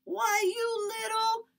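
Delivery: high, loud, slow outraged snarl; furious man grabbing at the boy; angry cry. Acted. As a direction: spit it out in fury. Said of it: "Mister Anderson cried".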